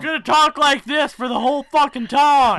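high, very loud bellow; mock shout. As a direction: blow up the hushed line into a comic yell. Bellowed parody of the quiet take.

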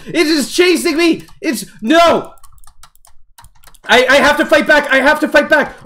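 high, loud frightened pleading; scared horror game streamer. Real, not acted. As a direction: panic while being chased. Zombie chasing, player panicking.

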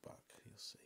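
hushed secret aside; whisper. Real, not acted. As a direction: whisper it to the viewers.

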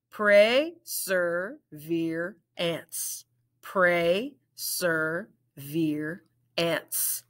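"Perseverance" drawn out slowly in parts; segmented "Perseverance"; slow, split into syllables. Shown as a demonstration. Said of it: "Let's blend it".

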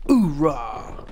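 lightly voiced gung-ho soldier cry; Tank Dempsey. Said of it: "As Tank Dempsey would say".

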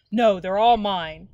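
slow, loud grouchy scream. A narrator's reading. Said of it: "screamed the grouchy ladybug".

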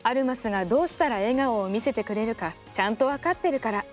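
usual speaking voice

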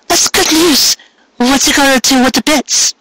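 a happy mare asking about the money; delighted mare voice. Acted.

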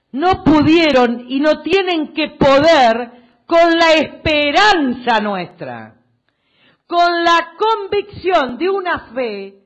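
loud, slow, weighty declaration; rousing rally oratory. Real, not acted. Politician rallying supporters around hope and faith, rousing oratory. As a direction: proclaim it slowly and forcefully to the crowd.

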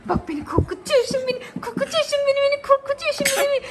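rising to a high, loud playful squeal. Real, not acted. Mother playfully teasing the baby.

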